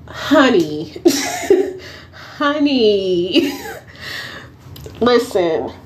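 laughing honey between giggles; giggly honey. Real, not acted. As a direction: chuckle through the scolding. Laughing while addressing her.

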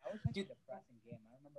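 muffled, covered microphone; talking with a hand over the mic; finger over the mic. Real, not acted. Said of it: "You're covering your mic".

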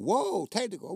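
falling, startled Whoa then technical; surprised whoa. Retold or imitated. Retelling the bench's reaction to a technical, startled. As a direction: say Whoa like you can't believe the call. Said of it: "we were saying".

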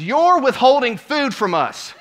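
high, loud, slow accusing shout; indignant yelling. Retold or imitated. Entitled lady yells at worker, loud and slow. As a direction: berate him over the desserts.

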